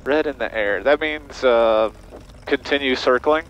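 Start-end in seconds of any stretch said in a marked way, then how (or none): none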